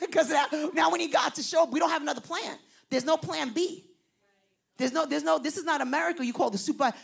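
fiery sermon delivery; preaching fervor. Real, not acted. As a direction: preach it with rising force. Preaching at full force.

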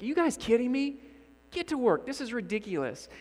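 fed-up worker snapping at coworkers; mock outburst. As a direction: snap at two bickering coworkers. Blowing up at arguing coworkers.

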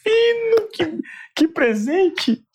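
rising, stretched-out amazement; slow, high, overwhelmed wonder; moved and astonished; amazed. Real, not acted. Host moved by the story.

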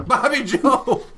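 laughing hillbilly call; loud laughing hillbilly yell; hillbilly trucker. Retold or imitated. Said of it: "and be like".

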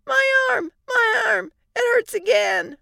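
put-on very high, loud pained toddler; child crying out. Little girl with hurt arm, high pained cries. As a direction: wail it out like a hurt little kid.

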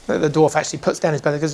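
normal talking voice

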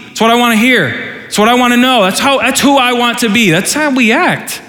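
high, pressing rant voice; preacher's escalating mock rant; rising rant. Real, not acted. Ranting about wanting anger.

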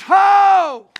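big shouted summons; prophet's loud call. Acted. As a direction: call out across the crowd like a herald.

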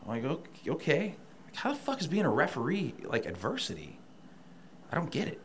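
incredulous. Retold or imitated. Incredulous retold reaction.

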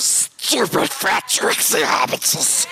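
slow, spat-out creature voice; nasty Gollum sneer, slow; Gollum impression. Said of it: "one of my best impressions".

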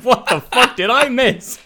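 high, laughing, a little slow; laughing bafflement. Retold or imitated. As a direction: laugh through the question. Laughing at the absurd ending.

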